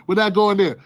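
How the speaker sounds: usual speaking voice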